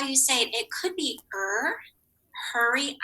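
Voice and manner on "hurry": ordinary delivery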